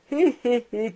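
lightly voiced laughing relief; acted chuckle. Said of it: "Il riait".

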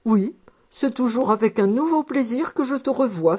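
plain reading voice

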